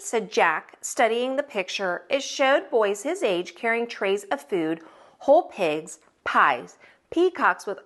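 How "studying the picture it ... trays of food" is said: plain reading voice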